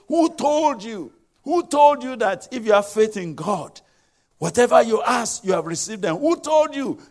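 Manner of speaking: loud, high-pitched demand; challenging sceptic. Acted. Voices a sceptic challenging the believer.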